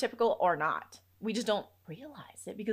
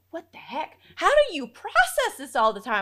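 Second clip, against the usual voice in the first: retold bewilderment; 7 semitones higher, 7 dB louder than usual. Retold overwhelm with new hearing aids.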